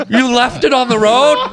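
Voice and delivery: gasp, then high incredulous question; mock horror. Producer horrified the dog was left behind, mock shock. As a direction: imitate her appalled disbelief. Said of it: "And she goes".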